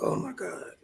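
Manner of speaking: whispered, barely voiced; breathless whisper. Real, not acted. Widow overwhelmed as the nurse starts crying, whispering. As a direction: let it slip out under your breath.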